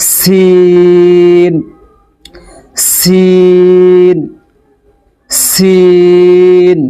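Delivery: সিন with a ringing s and the i held very long; slow, resonant, drawn-out সিন; long buzzing sin. Shown as a demonstration. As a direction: hiss the s of সিন, then sustain the vowel. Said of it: "এরকম বেজে উঠবে".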